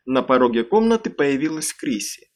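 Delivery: ordinary delivery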